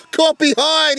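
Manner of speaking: loud, high celebratory shout; roaring the dismissal; excited shout. Real, not acted. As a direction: bellow it as the edge is taken. Wicket falls, shouted call.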